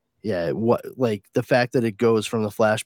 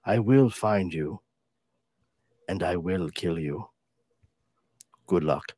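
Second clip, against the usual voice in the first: movie threat impression. Quoting the famous phone threat, mock menacing. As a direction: deliver it like a deadpan action hero. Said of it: "Brad Pitt?".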